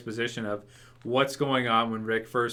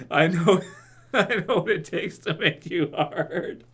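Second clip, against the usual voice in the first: laughing; 6 semitones higher than usual. Retold or imitated. Quoting the dub while laughing.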